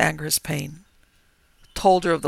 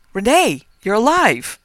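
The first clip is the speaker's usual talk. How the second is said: loud, higher cry of fear; 6 semitones higher, 10 dB louder than usual. Acted. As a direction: shout it in terror. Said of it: "cried out in fear".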